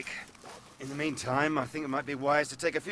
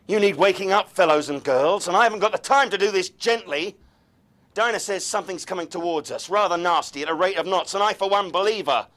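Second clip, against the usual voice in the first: forceful urgent; 6 semitones higher, 7 dB louder than usual. Acted. Urgent forceful address to group.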